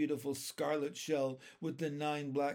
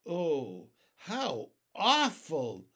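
horrified cry; 3 semitones higher, 6 dB louder, much slower than usual. A narrator's reading. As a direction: cry out in slow storybook horror. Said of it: "cried James".

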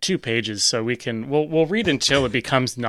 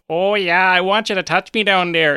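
higher, louder mock Wisconsin dirty talk; retelling a mock Wisconsin sultry line; Wisconsin accent; 7 semitones higher, 4 dB louder than usual. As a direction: ham up a thick Wisconsin accent. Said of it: "thick Wisconsinite accent".

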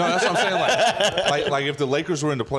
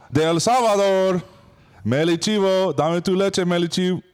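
Spanish TV host; 6 semitones higher, 6 dB louder than usual. Acted. Introducing guests in host voice.

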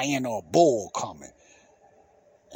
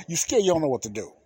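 ordinary talk, nothing marked

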